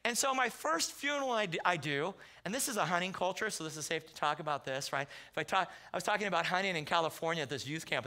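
ordinary talk, nothing marked

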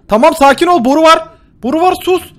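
loud, high panicked cries; urgent panic for air; panicked shouting. Real, not acted. Panikle boru arıyor. (panikle bağırarak)

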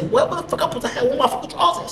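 high, fast flustered squeal; panicked reaction. Retold or imitated. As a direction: blurt it in a rising panic. Retold panic, hunting for clothes.

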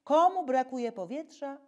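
usual speaking voice

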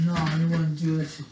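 usual speaking voice

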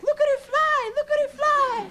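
put-on very high squeaky kid's voice; thrilled little kid shrieking with wonder; excited child. Child in the theatre crowd, high and squealing with joy. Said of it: "the children were ecstatic".

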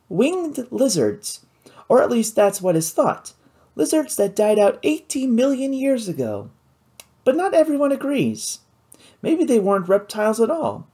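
put-on a lively, clever girl sharing her knowledge; Kate's voice. Kate explains the fossils.

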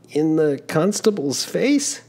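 put-on an embarrassed young man caught out; young traveler, flustered. Giovannino asks in disbelief.

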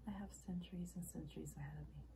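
soft and understated; hushed, restrained admission; subdued reflection. Acted. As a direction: say it quietly, almost privately. Acted monologue, subdued first take.

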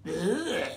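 drawn-out groan of disgust; disgusted ugh. A narrator's reading. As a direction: groan at a revolting taste. Lioness disgusted at eating mouse.

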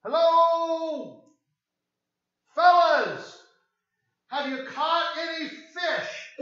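Jesus hailing the fishermen from the shore; calling out cheerfully. Pretend. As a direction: shout a friendly greeting from the shore.

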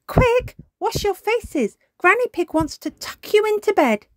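very high, loud mother voice; hurrying mother. A narrator's reading. Mummy Pig hurrying children to wash before bed, urgent. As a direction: chivvy the children along brightly.

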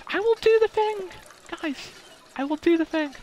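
very high squeaky mock voice; silly high voice. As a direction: squeak it out like a cartoon character.

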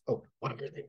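soft, hushed question; huddled on a ridge, hushing to the challenger; hushed whisper. A narrator's reading. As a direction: whisper it so the kobolds can't hear. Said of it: "I whisper to the challenger".

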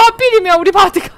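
hammed-up sobbing shout; fake-cry over the lost teammate; mock crying.

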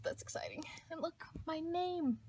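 breathless giddy whisper; delighted hushed whisper; excited whisper. Real, not acted. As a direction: whisper it like a secret thrill. Pointing out her name.

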